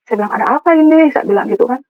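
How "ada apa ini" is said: higher-pitched, taken-aback question; startled retold question. Woman woken at night confronts husband's lawyers, startled. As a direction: ask it in raised, startled disbelief.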